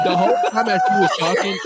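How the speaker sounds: high, loud, through laughter; laughing. Real, not acted. Friend recounting the episode, laughing loudly. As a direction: laugh the words out. Laughing while telling story.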